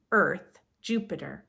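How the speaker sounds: usual speaking voice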